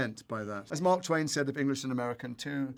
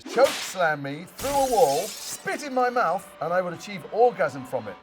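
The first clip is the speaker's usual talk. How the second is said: high, drawn-out theatrical declamation; proclaimed tweet; 7 semitones higher, 7 dB louder, slower than usual. Acted. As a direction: declaim each phrase slowly and forcefully. Reads fan tweet aloud.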